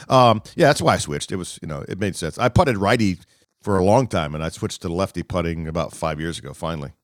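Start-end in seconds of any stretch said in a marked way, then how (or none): none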